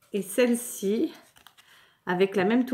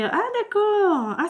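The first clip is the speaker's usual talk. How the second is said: sudden realization; 10 semitones higher, slower than usual. Real, not acted. Realizing how the card works.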